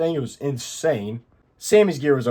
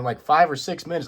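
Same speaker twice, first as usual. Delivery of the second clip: ordinary talk, nothing marked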